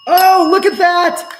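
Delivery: high, loud and thrilled, looking at the screen; a loud, high cry of surprise that keeps bubbling over; sudden excitement. Real, not acted. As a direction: burst out with real delight at what's happening. Something suddenly goes off on stream.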